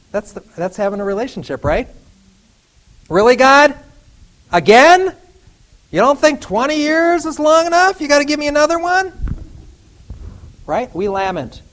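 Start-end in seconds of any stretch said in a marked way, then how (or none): 3.1-9.1 high, loud, fed-up whine at God; exasperated complaint. Acted. Preacher ranting at God, high and loud exasperation. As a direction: protest like you've had enough.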